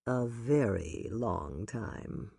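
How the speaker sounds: low, soft and slow, smiling; Ross, tender. A narrator's reading. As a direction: murmur it fondly down to her. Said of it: "smiling down at her".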